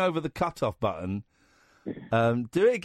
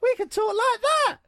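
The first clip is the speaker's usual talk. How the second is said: much higher, lifted voice; lightened voice; 13 semitones higher, 3 dB louder than usual. Shown as a demonstration. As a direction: lighten the voice to show how. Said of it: "lighten the voice a little bit".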